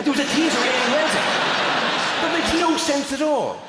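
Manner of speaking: high, loud incredulous rant; mock outrage. Comedian to a man who travelled from Reading, mock outraged. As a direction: protest in comic disbelief, pitched right up.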